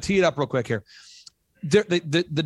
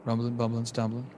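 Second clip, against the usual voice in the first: hushed, lower golf commentator; golf announcer voice; 3 semitones lower than usual. Acted. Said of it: "I'll kinda get into my golf announcer voice here".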